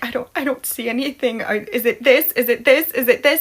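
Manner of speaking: rapid-fire repeated questions, rising each time; stumble, then fire off the guesses; a touch of flustered panic; mild retold panic. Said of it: "and I'm panicking".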